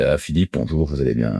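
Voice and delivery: low, softened middle-aged phone manner; older man's phone voice. Retold or imitated. Young salesman greeting a client by phone, sounding fifty. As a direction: play a fifty-year-old on a sales call. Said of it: "on pensait que j'avais cinquante balais".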